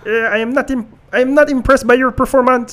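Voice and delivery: squeaky Kermit-style French Canadian trash talk; French Canadian accent. Retold or imitated. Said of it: "Every time I do his, uh, accent, it sounds like, uh, Kermit the Frog".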